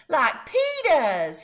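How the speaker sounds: put-on high, slow toddler voice; little kid's demand. A narrator's reading. Fudge telling his mother which shoes he wants. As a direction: say it like a small child deciding.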